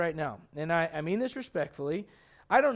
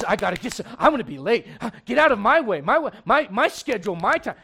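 impatient driver; 3 semitones higher, 8 dB louder than usual. Retold or imitated. Pastor mocking a driver who speeds up, loud mock grumble. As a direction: play the self-absorbed driver cutting in.

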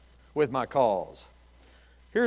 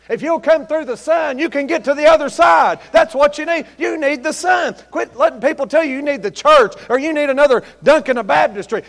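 high, loud, fervent preaching; preaching at full force; 9 semitones higher, 8 dB louder than usual. Acted. Preacher rousing the congregation, shouting fervently. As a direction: lift the whole church with it.

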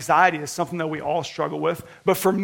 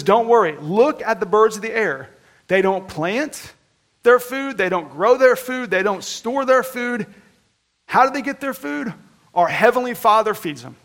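quoting scripture; 4 semitones higher, 4 dB louder than usual. Acted. Preacher quoting Jesus on birds.

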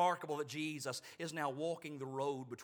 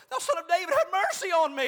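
loud, high, insistent plea; crying out louder; 17 dB louder than usual. Acted. Blind beggar refusing to hold his peace, shouting desperately to Jesus. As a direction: cry out all the more for mercy. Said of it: "he cried the more a great deal".

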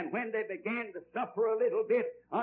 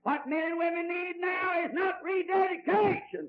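high-pitched preacher's shout; impassioned preaching; 9 semitones higher, 4 dB louder than usual. Real, not acted. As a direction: cry it out to the congregation. Preacher hammers his point.

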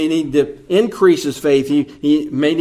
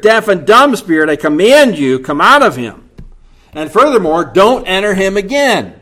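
louder commanding rebuke; stern rebuke; 4 semitones higher, 5 dB louder than usual. Retold or imitated. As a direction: command the spirit out with authority. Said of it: "Jesus then rebukes the spirit".

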